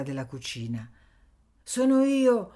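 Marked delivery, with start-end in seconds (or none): 1.6-2.5 much louder, higher call across the yard; shout. Acted. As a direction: call out to be heard. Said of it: "gridò Anania". (grido forte, molto più alto)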